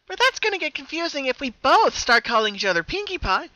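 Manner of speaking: high, loud, chatty; happy Pinkie explaining to her double, chatty; explaining brightly. Acted. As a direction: work it out aloud, bouncy. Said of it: "she explained".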